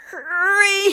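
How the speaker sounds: high, loud, straining grunt; grunted under great strain. Acted. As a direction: squeeze it out while holding back a burst. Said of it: "he grunted".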